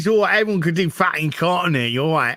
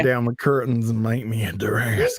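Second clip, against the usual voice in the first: Southern accent; 5 semitones lower than usual. Shown as a demonstration. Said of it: "about to bust into a country song".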